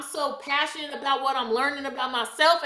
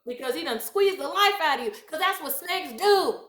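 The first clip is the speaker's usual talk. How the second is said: ordinary talk, nothing marked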